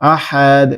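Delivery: আহাদ with a bounced final দ; vibrating extra release on the end of আহাদ; echoing qalqala. Shown as a demonstration. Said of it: "এরকম একটা দাল extra আসবে".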